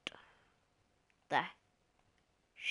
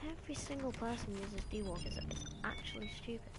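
soft complaint in the usual pitch; subdued grumble; 8 dB quieter than usual. Real, not acted. Gamer grumbling about everyone's weapon, subdued and soft. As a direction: let it sink to a quiet gripe.

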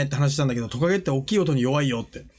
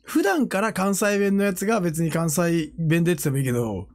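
ordinary talk

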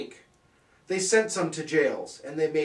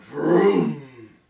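vroom; 9 dB louder than usual. A narrator's reading. Shroom goes off.

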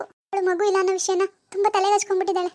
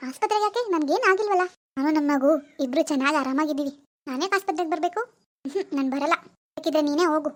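put-on lower, hushed young woman lost in a delusion; disturbed daughter; 4 semitones lower than usual. Daughter Swathi's role voice.

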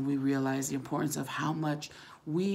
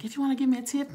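higher, louder teasing quote; joking request; 10 semitones higher, 5 dB louder, much faster than usual. Retold or imitated. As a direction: playfully mimic him asking, pitched up. Retelling man's joking request.

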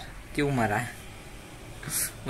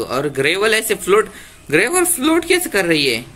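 surprised; 6 semitones higher, 4 dB louder than usual. Real, not acted. Floating gravel in Minecraft.

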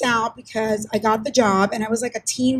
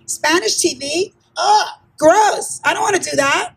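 higher-pitched, scornful recoil; disgusted; 6 semitones higher than usual. Retold or imitated. Young reporter turning down a little Spanish TV station, mock disgust. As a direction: play a young person turning up their nose. Young self rejects job offer.

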